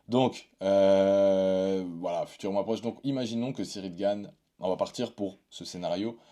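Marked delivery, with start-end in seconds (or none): none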